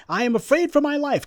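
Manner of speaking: high mocking whine of a fake plea; mock asylum plea. Commentator mocking the migrants' asylum claim, high mock whine. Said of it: "you go to the United States and say".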